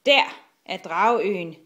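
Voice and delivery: loud lookout's call; shout. A narrator's reading. Killer spots the dragon island, calling out. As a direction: call out the sighting. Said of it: "råbte Killer".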